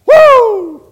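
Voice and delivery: high, loud exultant woo; joyful whoop. Real, not acted. Preacher whooping with joy before the congregation. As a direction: let out a shout of joy.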